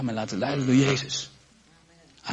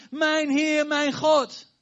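high and louder, in awe; Thomas's cry, high and louder, in awe; awed confession; 10 semitones higher, 3 dB louder, much slower than usual. Retold or imitated. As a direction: cry out the confession like a man struck by wonder. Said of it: "Het enige wat hij zegt is".

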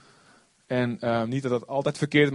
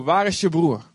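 God asking; 4 semitones higher, 4 dB louder than usual. Retold or imitated. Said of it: "komt God na die tijd naar hem toe".

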